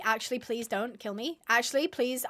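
ordinary talk, nothing marked

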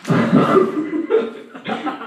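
airy laugh. Real, not acted. Laughing at himself.

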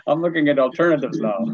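laughing through it. Retold or imitated. Applicant explaining to law school registrar, laughing.

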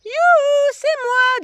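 very high, loud yoo-hoo through the door; singsong yoo-hoo call. A narrator's reading. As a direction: sing out to announce yourself. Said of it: "dit une voix". (youhou chantonné très aigu)

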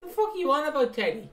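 hammed-up Phil demanding to mew; mock exasperated Phil. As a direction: squeal it like an outraged cockney hard man. Playing Phil answering Teddy.

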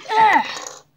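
sudden high cry of fright; frightened cry. Real, not acted. Player scared by a monster, high cry. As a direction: yelp as something lunges. Jumpscare in the mine.

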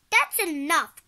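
loud, exasperated cry. A narrator's reading. Mom silencing the kids, crying out sharply. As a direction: cut the squabble off loudly. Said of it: "cried Mom".

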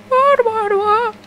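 squeaky high what, what, what; stunned disbelief. Real, not acted. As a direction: shriek it in disbelief. Shocked by bike flip.

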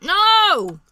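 loud, high alarmed shout. Real, not acted. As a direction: yell to stop a friend. Stopping friend touching the puzzle.

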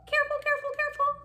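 tiny high-pitched careful voice; mock nervous caution. Reader pretends to carry a spiky sea urchin, nervously. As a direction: squeak it as if holding something prickly.

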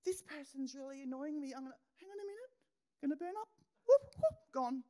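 high, light, playful mock voice with a loud whoop; voicing her thoughts as they go up in flames, mock-breezy; mock inner voice. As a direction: act out brushing the annoyance off, high and airy. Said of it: "I literally go".

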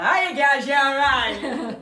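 loud, brash bingo caller voice. Retold or imitated. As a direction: call it out like a bingo hall host. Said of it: "I sounded like a bingo caller".

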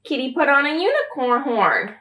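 ordinary delivery

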